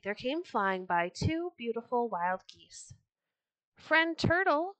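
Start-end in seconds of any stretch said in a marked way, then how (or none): 3.8-4.8 high, slow storybook hail; calling out. A narrator's reading. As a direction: call out to a friend from above. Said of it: "cried the geese".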